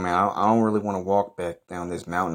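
ordinary talk, nothing marked

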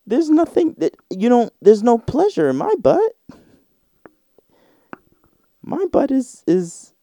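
high disbelieving dude rant; high incredulous squeal. Retold or imitated. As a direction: squeak it out, baffled by the idea.

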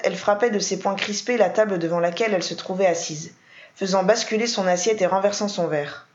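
plain reading voice

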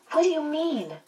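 high, pleading question; plaintive. A narrator's reading. Meg puzzled by the answer, in a high, plaintive voice. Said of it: "Meg asked plaintively".

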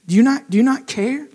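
ordinary delivery

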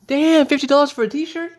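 high loud shocked outburst. Acted. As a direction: shriek at the price. Repeat shocked at price.

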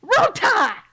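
very high, loud fan yell; worked-up high shouting; shouted rallying cry. Real, not acted. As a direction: holler it like a rowdy football fan. Shouting team chant in argument.

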